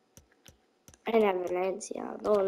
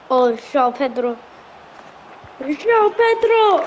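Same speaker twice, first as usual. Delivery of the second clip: excited cheering; 5 semitones higher, 11 dB louder than usual. Real, not acted. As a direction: cheer the goal, building up. Celebrating a goal.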